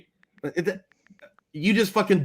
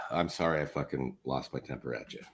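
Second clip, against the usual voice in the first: subdued apology; 14 dB quieter than usual. Real, not acted. Apology after blowup, sighing.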